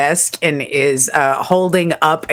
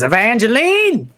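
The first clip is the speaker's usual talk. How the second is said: ringleader calling his daughter, raised and higher; 7 semitones higher than usual. Acted. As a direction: call her name across the woods. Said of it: "and he goes".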